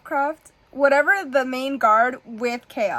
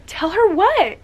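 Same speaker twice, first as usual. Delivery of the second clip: loud, high outburst; shocked demand; 8 semitones higher, 8 dB louder, slower than usual. Real, not acted. Reader yelling back at the brother's line, shocked. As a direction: demand the secret at the top of your voice.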